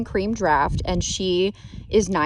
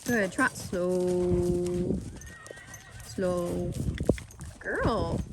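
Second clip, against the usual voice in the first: talking to a horse; 3 semitones lower, 6 dB quieter, much slower than usual. Real, not acted. Lunging the horse.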